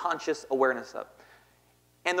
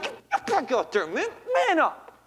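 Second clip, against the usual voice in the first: tough coach; 10 semitones higher, slower than usual. Retold or imitated. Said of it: "He's like".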